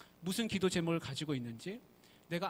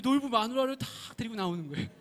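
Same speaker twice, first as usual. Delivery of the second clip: ordinary talk, nothing marked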